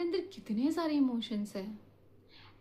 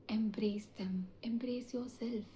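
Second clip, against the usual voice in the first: whisper; 5 dB quieter, much slower than usual. Real, not acted. Whispered encouragement.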